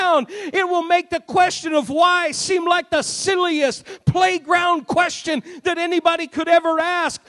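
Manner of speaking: loud, high, rising preaching shout; full-force preaching. Acted. Preacher shouting to the congregation, loud and high.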